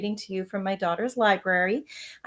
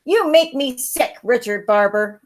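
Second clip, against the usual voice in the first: mild mock yell, higher; 4 semitones higher, 8 dB louder than usual. Sister yelling at her brother after losing, mild yell. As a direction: tell him off. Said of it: "I yelled at him".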